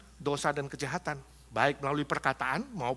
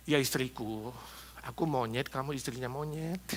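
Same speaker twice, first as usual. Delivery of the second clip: low, soft, meek husband; reply softly and mildly to an angry wife; acted lowered, placating voice; gentle soft answer; 6 semitones lower, 8 dB quieter than usual. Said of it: "lemah lembut".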